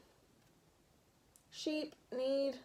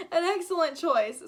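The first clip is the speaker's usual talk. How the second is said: put-on thick German accent, louder and grand; 4 semitones higher, 7 dB louder than usual. Gutenberg hologram welcoming the teammates, in a thick German accent. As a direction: greet them like a proud old German printer. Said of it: "said a man with a thick German accent".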